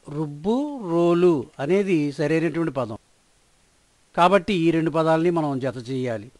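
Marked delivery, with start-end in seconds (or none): none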